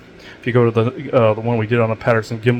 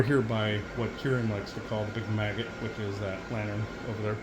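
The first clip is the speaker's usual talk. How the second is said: quiet; 15 dB quieter than usual. Real, not acted. Hushed while pointing at lantern.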